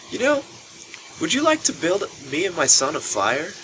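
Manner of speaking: put-on high, sing-song drunk lady voice; hammed-up tipsy mom asking a favour; a drunk middle-aged woman sweetly asks strangers for a fire. Voicing the drunk woman Sonia.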